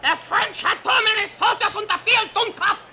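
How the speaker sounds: put-on high, loud German-accented yell; angry Swiss-German shout. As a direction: bark it like an irritated Swiss soldier, ending on "Dummkopf!". Swiss mercenary snaps at reporter.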